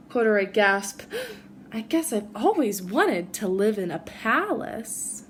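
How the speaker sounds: put-on gentle read-aloud for children; reading a story to a class; storybook reading. A narrator's reading. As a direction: tell it like a bedtime picture book. Reads picture book to kids.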